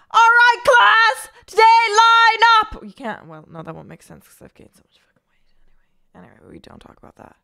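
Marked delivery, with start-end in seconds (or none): 0.1-2.8 loud, high, slow gym teacher bark; PE teacher shout. Pretend. Streamer playing a PE teacher calling the class to order, loud high shout. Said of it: "I wanna be a P E teacher". 3.5-7.4 whisper. Real, not acted. Self-deprecating aside about weight.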